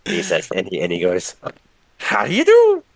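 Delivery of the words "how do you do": very high, loud squeaky greeting; drunk Mister Rigby. Acted. Drunk Rigby pops from drain, greeting loudly and squeakily. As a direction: greet them with a silly rising squeal.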